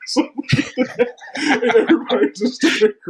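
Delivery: talking through real laughter; high, breaking into laughs at the memory; laughing. Real, not acted. Laughing at his own story.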